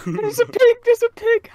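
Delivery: high, loud delighted call; excited. Real, not acted. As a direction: squeal at the pig. Spotting a pig.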